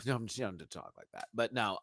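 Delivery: ordinary talk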